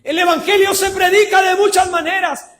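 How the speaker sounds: loud, high preaching shout; emphatic proclamation. Real, not acted. Preacher declaring a truth to the congregation, emphatic proclamation. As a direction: proclaim it forcefully to the whole room.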